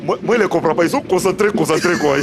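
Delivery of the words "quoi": ordinary delivery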